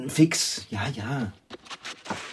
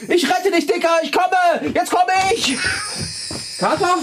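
calling out. Acted. Owner charging out to save her cat, shouting. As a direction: rush in yelling to the rescue. Calling out while rushing to rescue.

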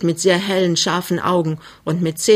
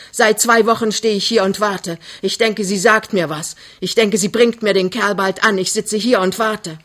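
pressing, raised-pitch reproach; angry tirade; 3 semitones higher, 3 dB louder than usual. Acted. Mother berates the suitor about waiting, angrily. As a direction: pour out the pent-up grievance.

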